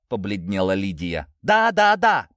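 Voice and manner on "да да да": high, loud repeated yes; shouting. A narrator's reading. Natasha confessing to her parents, shouting. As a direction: shout each yes out in desperation. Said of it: "Наташа перешла на крик".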